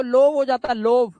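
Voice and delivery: लोव with a long o, like "lohv"; wrong vowel demo. Shown as a demonstration. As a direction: say लोव with O as written. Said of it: "अगर ओ पढ़ते तो ये लव नहीं होता". (लोव को लंबे ओ से बोलना)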